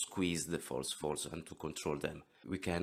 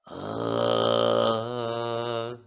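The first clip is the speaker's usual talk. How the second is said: squeezed throat growl; false fold rasp; 4 semitones higher, 8 dB louder than usual. Shown as a demonstration. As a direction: demo a rough false-fold sound. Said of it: "so those are my false, uh, vocal folds".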